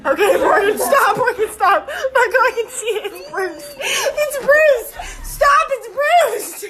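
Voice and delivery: high-pitched squeals through laughter; squealing protest. Real, not acted. Telling Morgan to stop over a bruise, in laughing squeals. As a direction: plead to stop, rising into a shriek.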